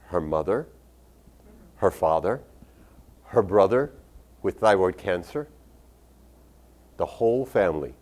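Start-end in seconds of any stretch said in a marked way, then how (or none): none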